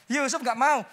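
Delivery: firm mock refusal; acted refusal. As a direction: refuse readily, playing Yusuf at first. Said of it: "Lama-lama gimana?". (menolak dengan tegas, berperan)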